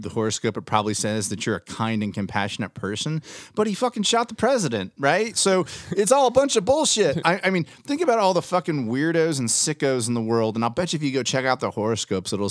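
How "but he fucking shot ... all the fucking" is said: much higher and louder, half-shouting in disbelief; heated rant. Real, not acted. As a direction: throw the outrage at it, voice jumping up. Ranting about horoscopes and Oswald.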